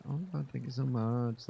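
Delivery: a hint of coy, simpering gratitude; lightly voiced cutesy mock thanks; cutesy thank-you. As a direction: lightly play a sweetly gushing streamer. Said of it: "being a little bit cutesy".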